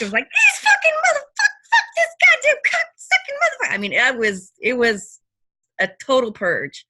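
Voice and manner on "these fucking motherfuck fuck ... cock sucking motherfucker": raging high scream of curses; high furious screaming with a cry in it; reenacted screaming. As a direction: scream it out like a purge at the cemetery. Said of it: "I screamed and hollered and kicked".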